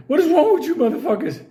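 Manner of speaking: high, loud disbelieving outburst at the brawlers; a mock scolding yelp from the field director; hammed-up furious supervisor; exasperated boss. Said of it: "he's like".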